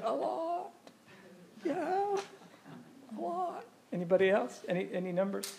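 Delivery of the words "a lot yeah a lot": very high, soft falsetto imitation; mimicked audience answer. Speaker echoes someone's answer about the tax burden, squeaky mimicry. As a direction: squeak it out in a small mock falsetto.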